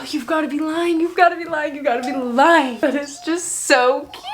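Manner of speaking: a giddy disbelieving swoon; swooning disbelief. Real, not acted. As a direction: melt over the cute line. Swooning over a cute line.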